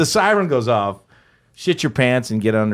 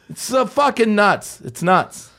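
ordinary talk, nothing marked

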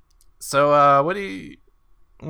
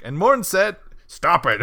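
mock announcer; 7 semitones higher, 7 dB louder, slower than usual. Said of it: "he's like".

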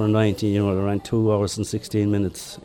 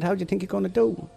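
put-on Boston accent; 10 semitones higher, faster than usual. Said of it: "in his great Boston accent".

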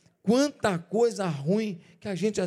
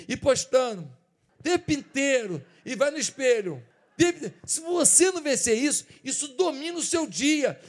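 loud, driving sermon voice; fiery preaching; 3 semitones higher, 6 dB louder than usual. Acted. Pastor urges the church to fight their desires, loud and driving. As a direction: push the point hard at the congregation.

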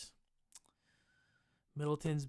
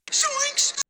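squeaky cartoon yelp way up high; cartoon Zoinks; 10 dB louder than usual. Podcaster throws in a cartoon catchphrase, squeaky high yelp. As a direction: yelp it like a scared cartoon sidekick.

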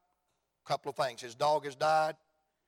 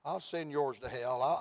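usual speaking voice

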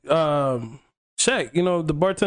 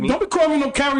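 higher-pitched retold protest; affronted objection, pitched up; offended; 7 semitones higher, faster than usual. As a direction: push back at the insult, mock-indignant. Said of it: "I felt offended".

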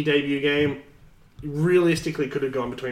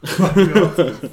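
loud, laughing echo; laughing exclamation; 4 semitones higher, 8 dB louder than usual. Real, not acted. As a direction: repeat it through laughter.

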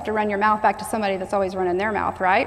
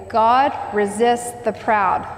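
drawn-out, each word weighted; preacher leading a repeat, slow; slow call-and-repeat; slower than usual. Shown as a demonstration. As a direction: say it slowly so they echo it. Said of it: "Say that with me".

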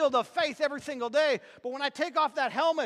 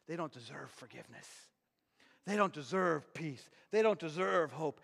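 devil whispering; 9 semitones lower, 7 dB quieter than usual. Acted. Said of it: "he begins to whisper in your ear".

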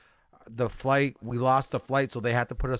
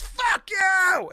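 retold shout. Stranger yells at him.

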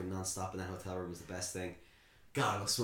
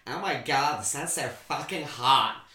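put-on loud, slow, higher-pitched American girl accent; American beach girl; 3 semitones higher, 7 dB louder, slower than usual. American girl on a beach in Spain, loud drawling American accent. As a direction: imitate a drawn-out American girl's intonation. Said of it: "that intonation has stayed in my brain".

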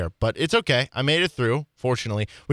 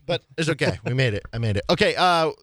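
normal talking voice

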